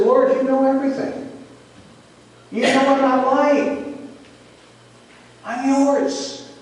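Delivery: high-pitched earnest pledge; heartfelt surrender. Retold or imitated. Peter yielding to the Lord, high and pleading. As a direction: plead it in short phrases, voice lifted high.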